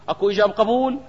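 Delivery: ordinary talk, nothing marked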